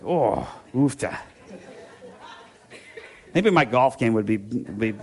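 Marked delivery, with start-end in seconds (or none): 0.0-1.2 slow, drawn-out Minnesota sigh of a word; mock Minnesotan dismay; Minnesota exclamation. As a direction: groan it slowly in a Minnesota accent. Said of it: "it's like an exclamation".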